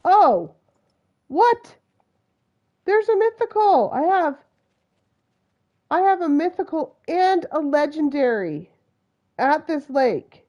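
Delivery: high, loud disbelief; astonished excitement. Real, not acted. Player finding mythical and legendary at lake, astonished. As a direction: exclaim at an incredible find.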